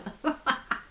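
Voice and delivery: brief laugh at her own stumble; short laugh. Real, not acted. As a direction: burst into a short laugh after botching it. Laughs at own botched pronunciation. (petit rire)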